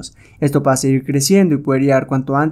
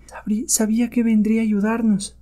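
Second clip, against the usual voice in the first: high-pitched, hushed relief; high voice full of hope; relieved captive; 8 semitones higher, 4 dB quieter than usual. Acted. Captive to the rescuer who came to help, relieved.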